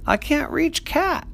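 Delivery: high, slow, straining voice. Acted. Big sister stretching her arm into the hole, straining. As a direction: reach and strain for it.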